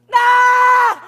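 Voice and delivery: loud, high bark at the prisoner; furious shout. Acted. Guard yelling at the prisoner, a furious shout. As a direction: yell it in his face.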